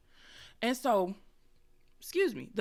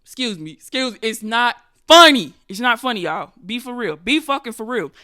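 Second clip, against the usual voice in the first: loud scolding; 11 dB louder than usual. Real, not acted. Laughing, scolding herself and the crowd.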